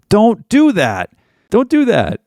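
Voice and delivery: high, slow "Don't do that"; a sharp no-nonsense warning; firm warning. Real, not acted. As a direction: stress do, hard and firm. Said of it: "stern warning, said twice".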